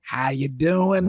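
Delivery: thick put-on Boston accent; old Bostonian man, Boston accent.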